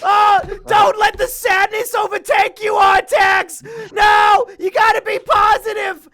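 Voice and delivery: yelled high and loud, hammed-up; over-the-top mock yell at the horse; mock desperate shout. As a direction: play the boy screaming at his sinking horse. Retelling Atreyu shouting at horse.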